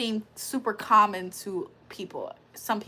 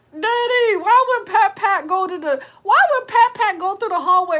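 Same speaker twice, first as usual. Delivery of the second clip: high, loud cartoon kid question; Cleveland Brown Junior; 7 semitones higher, 6 dB louder than usual. Child asking Daddy about Pat Pat, high loud impression. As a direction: pipe up like an animated son. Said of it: "Why he sound like that?".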